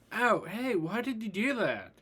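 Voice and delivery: high-pitched acted complaint; high, put-upon "ow, hey"; hurt protest. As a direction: protest a bonk on the head, voice jumping up. Bonked on the head, protests.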